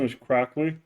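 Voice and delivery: usual speaking voice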